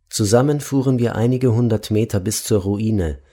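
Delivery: usual speaking voice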